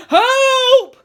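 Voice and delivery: high, full-volume scream for help; panicked scream. A narrator's reading. Teacher racing off after the supposed fall, panicked scream. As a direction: scream for rescue. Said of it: "screamed Mister Nurden".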